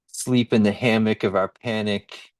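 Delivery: ordinary delivery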